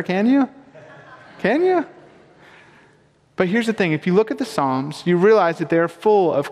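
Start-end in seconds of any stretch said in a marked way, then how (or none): 0.0-1.9 can you? pitched up higher, much higher on the repeat; mock disbelief. Preacher asking congregation if complaining is allowed, incredulous high. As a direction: tease the crowd with a high incredulous question.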